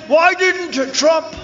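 high, slow whiny mock of an opponent; hammed-up mimic of Biden's question. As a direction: stretch it out, mocking the complaint. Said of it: "He said".

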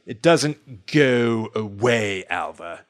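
put-on slow, low, affected unplaceable accent; drawn-out joke accent, vaguely British; terrible unplaceable accent. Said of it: "it's a terrible accent".

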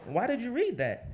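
high, laughing mock scorn; laughing impression of a scornful editor. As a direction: ridicule the student's sentence. Editor mocking a student.

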